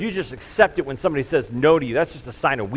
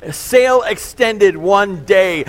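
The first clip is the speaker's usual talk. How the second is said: mock dramatic read; 6 semitones higher, 7 dB louder, slower than usual. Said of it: "an email that said".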